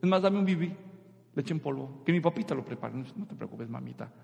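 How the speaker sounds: very soft, low, meek apology; baby apologizing. Pretend. Baby apologizing to mom, very soft and meek. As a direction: murmur it softly and obligingly. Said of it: "Entonces el niño dice".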